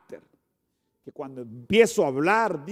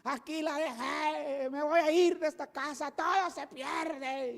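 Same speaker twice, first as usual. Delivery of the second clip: mock frantic son; 9 semitones higher, 5 dB quieter, slower than usual. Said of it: "empiezas en la mañana a decir".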